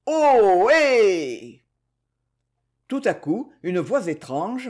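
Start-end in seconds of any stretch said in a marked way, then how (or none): 0.1-1.6 loud, high drawn-out herder's holler; two-tone mountain call. A narrator's reading. Pierre calls through cupped hands like Savoy cowherds, two-tone holler. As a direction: call across the mountains in two long notes. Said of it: "il n'aurait crié que".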